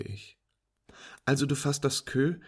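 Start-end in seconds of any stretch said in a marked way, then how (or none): 1.2-2.4 higher, louder teasing friend voice; amused female friend. Acted. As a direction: play her as an incredulous, teasing pool player.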